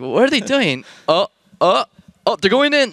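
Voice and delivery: high, loud shocked exclaiming; excited disbelief. Retold or imitated. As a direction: react like you can't believe it. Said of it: "and we're like".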